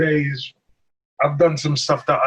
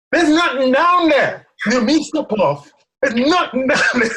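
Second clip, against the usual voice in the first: hammed-up disbelief, pitched up; alarmed protest; 9 semitones higher than usual. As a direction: protest in mock alarm from up high. Retells his alarm up high.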